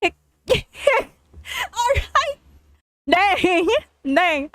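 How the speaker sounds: high, helpless laughing words; crying with laughter. Real, not acted. Reader cracking up at the story, crying with laughter. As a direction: choke out words through a laughing fit. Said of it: "I'm crying at the".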